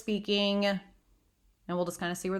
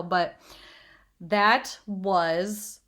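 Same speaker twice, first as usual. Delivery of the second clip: normal talking voice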